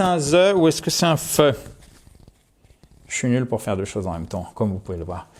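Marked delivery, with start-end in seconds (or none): 3.1-5.3 quick, low, soft mumble to self; sheepish aside. Real, not acted. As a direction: toss it off low, soft and fast. Embarrassed aside after microphone mishap. (aparté gêné, bas et rapide)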